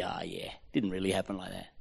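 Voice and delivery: low, soft cynical aside. Acted. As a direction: grumble doubt under your breath. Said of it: "It's void of cynicism".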